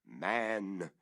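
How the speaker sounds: solemn verse reading that builds; dramatic poem recital. A narrator's reading. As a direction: recite it like a dark incantation.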